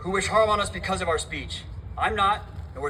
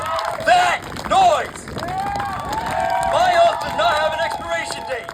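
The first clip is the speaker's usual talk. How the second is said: high defiant shout; 11 semitones higher, 6 dB louder than usual. Real, not acted. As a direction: spit it out to the crowd. Rally outburst.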